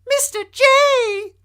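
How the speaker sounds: shrill-high, loud slow squeal; Harley's scream. Acted. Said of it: "and Harley screamed".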